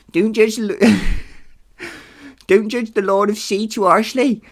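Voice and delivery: high, put-on Nereid voice. Nereid urging someone not to judge the sea lord, high put-on voice. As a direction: speak loud and high as a sea nymph. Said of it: "the Nereid told me".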